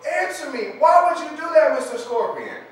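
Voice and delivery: high, loud, pleading shout; frog screaming. Acted. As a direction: scream it at him, demanding an answer. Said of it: "And the frog goes".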